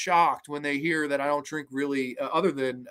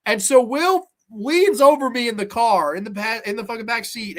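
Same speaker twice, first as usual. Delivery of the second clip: ordinary talk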